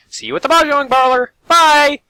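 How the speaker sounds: high, loud chirpy farewell; cheery high goodbye. Acted. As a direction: sing out a bright bye as she runs off.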